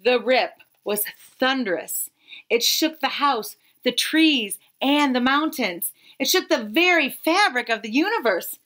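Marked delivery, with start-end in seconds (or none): none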